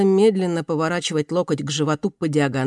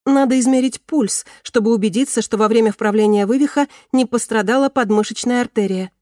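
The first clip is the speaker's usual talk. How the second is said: higher, louder recited answer; eager student answering; 6 semitones higher, 5 dB louder than usual. A narrator's reading. Student answering her doctor's quiz, eagerly reciting. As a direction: recite it keenly, pitched up.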